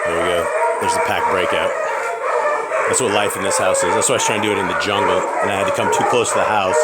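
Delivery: loud, talking over barking dogs. Real, not acted. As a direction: push the voice over the noisy pack. Shouting over barking pit bulls.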